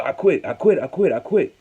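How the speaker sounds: imitated desperate surrender; retold yelling. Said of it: "he was, uh, yelling".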